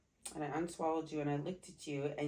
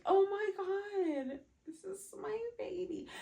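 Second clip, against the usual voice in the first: crying; 12 semitones higher than usual. Real, not acted. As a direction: recall it tenderly through tears. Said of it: "she's crying".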